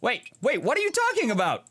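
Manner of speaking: high-pitched incredulous objection; indignant protest. Real, not acted. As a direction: push back, baffled by the criticism. Protesting the game's insult.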